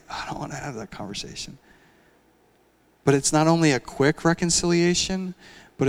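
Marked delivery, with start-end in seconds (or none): 0.1-1.6 reluctant mumble. Retold or imitated. Quote repeated quieter and faster.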